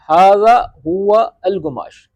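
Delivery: slow, careful ھذا ھو الگماش; teacher modelling slowly; slow model phrase. Shown as a demonstration. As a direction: dictate it slowly. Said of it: "یعنی کہ یہ وہ کپڑا ہے".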